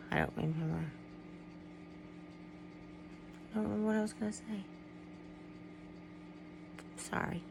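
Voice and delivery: soft and halting, with long pauses and a sigh; hushed, apologetic, thrown by a sudden memory; upset, losing the thread. Real, not acted. Vlogger overcome remembering her Great Dane, quietly upset.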